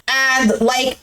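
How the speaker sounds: ordinary talk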